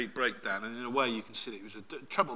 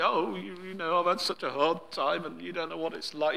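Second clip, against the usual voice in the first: higher-pitched mock tantrum of self-pity; sulky whining; 4 semitones higher than usual. Preacher mimics Elijah complaining to God, whining. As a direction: whinge like a sulking child. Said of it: "throws his teddies out of the pram".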